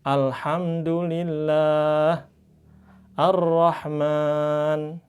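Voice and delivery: Alhamdulillah, Ar-Rahman chanted very slowly; Quranic recitation. Shown as a demonstration. Teacher giving examples to students, slow Quranic chant. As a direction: recite each word drawn out like in prayer. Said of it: "maka bacanya pakai al".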